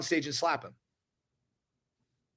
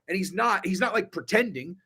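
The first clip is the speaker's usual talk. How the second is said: ordinary talk, nothing marked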